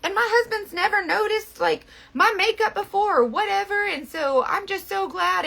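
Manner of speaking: high, gushing, tearful imitation; tearful customer. Mimicking a customer crying happily over her makeup. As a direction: pitch it up like someone crying happy tears. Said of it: "And she's like".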